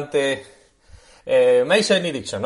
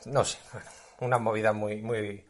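drinking water; 5 semitones lower, 10 dB quieter than usual. Real, not acted. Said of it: "perdón, que estoy bebiendo agua".

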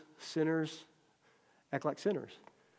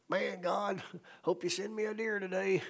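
higher-pitched imitated prayer; casual mock prayer; 5 semitones higher than usual. Imitating a hunter praying while driving, casual mock prayer. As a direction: play an everyday guy praying on the road.

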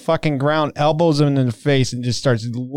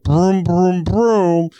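high "broom, broom" engine noises; imitated car engine; 6 semitones higher, much slower than usual. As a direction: play at being a revving car. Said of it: "driving a steering wheel".

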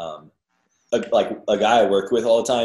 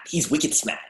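put-on Boston accent; 2 semitones higher, 4 dB quieter, slower than usual. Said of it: "the accents were very good in it".

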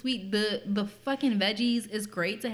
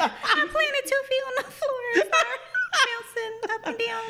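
high mock voice; 13 semitones higher than usual. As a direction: tell it in a high, giggly voice. Retells in a high put-on voice.

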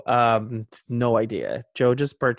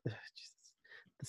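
whispered aside; 26 dB quieter than usual. Real, not acted. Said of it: "frustrated aside".

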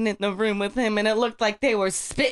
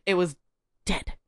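ordinary talk

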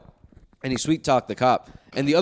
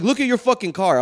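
angry insistence; 9 semitones higher, 4 dB louder than usual. Retold or imitated. Arguing with other driver.

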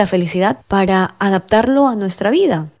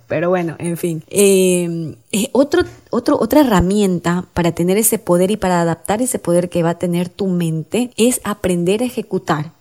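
normal talking voice

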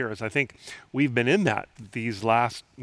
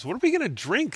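a touch of a demanding crowd, pitched up; mock complaint, higher; 9 semitones higher, faster than usual. A narrator's reading. Israelites complaining to Moses, lightly demanding. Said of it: "they demanded".

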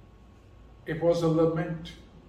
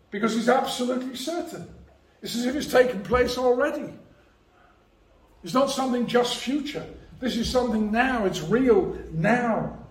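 rising conviction; 6 semitones higher, 3 dB louder, faster than usual. Real, not acted. Preacher rising in certainty.

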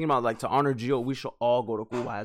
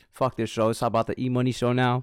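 normal talking voice

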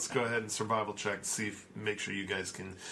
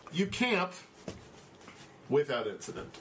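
normal talking voice

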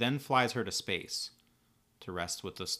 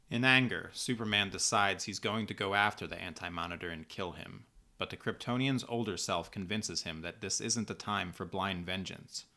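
ordinary delivery

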